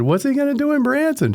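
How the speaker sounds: squeaky high incredulous question; can't imagine a reason; disbelief. Retold or imitated.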